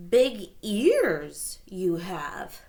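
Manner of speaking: very slow wondering words; slowly. Acted. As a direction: draw each word out slowly. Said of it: "she said slowly".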